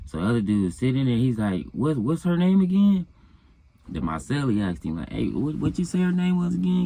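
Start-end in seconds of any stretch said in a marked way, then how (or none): 1.7-3.1 pitched way up, suspicious question; high curious dude. Retold or imitated. Dude in the yard pressing for the girl's name, high pointed question. 5.1-6.8 pitched way up, insistent question; high pressing celly. Retold or imitated. Cellmate pressing the bragging inmate, high insistent question.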